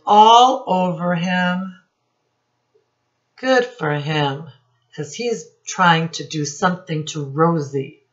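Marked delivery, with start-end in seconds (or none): none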